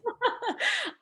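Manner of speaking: a sudden high laugh of disbelief; laughing at the surprise; high burst of laughter. Real, not acted. Disbelief at all-blonde presenters.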